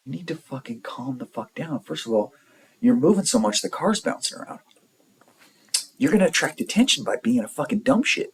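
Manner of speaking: mildly heated retelling of a dressing-down; retold telling-off. Telling panicking Matt to calm down in the car, retold scolding.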